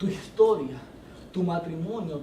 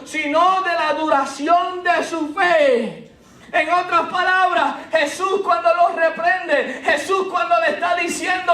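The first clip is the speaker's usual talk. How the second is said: high, loud, slow impassioned preaching; full-force preaching; 11 semitones higher, 10 dB louder, slower than usual. Acted. Pastor preaching to church on Peter's faith, full force. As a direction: pour it out over the congregation.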